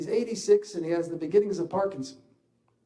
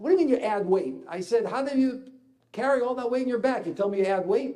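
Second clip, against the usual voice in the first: louder, higher mock incredulous challenge; cynical disbelief; 5 semitones higher, 6 dB louder than usual. Father scoffing at his son's claim, loud cynical disbelief. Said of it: "Stop your cynicism".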